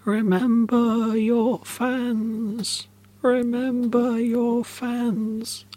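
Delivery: high, very slow, drawn-out wail of words; fading ghostly refrain. A narrator's reading. Ghost leaves the team with a refrain, high and fading. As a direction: chant it high like a spirit drifting away, fading. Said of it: "Only phrase repeated until it faded".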